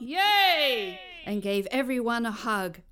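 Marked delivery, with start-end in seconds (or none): 0.0-1.0 cheering. Acted. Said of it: "They yelled out".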